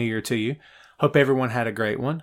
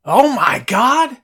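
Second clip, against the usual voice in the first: long, high drawn-out exclamation; amazed gasp of delight; surprised; 11 semitones higher, 7 dB louder, much slower than usual. Real, not acted. Excited reaction to the suggestion.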